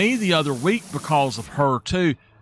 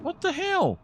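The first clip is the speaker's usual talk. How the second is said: sudden high exclamation, real surprise; startled high what the hell; 4 dB quieter than usual. Real, not acted. Reacting to something going wrong, high startled cry. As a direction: yelp it out, caught off guard.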